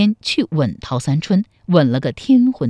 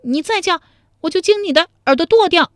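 high, loud fast menacing threat; vicious threat; 12 semitones higher, 5 dB louder, faster than usual. Acted. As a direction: threaten to cut off his ear. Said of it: "陶三春恶狠狠地说". (恶狠狠地威胁)

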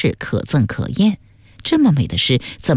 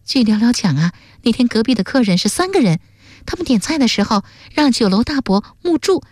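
put-on high, excited girl's voice; high-voiced, eager reasoning; eager young sleuth girl; 9 semitones higher, faster than usual. A narrator's reading. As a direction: argue the theory keenly, pitched high.

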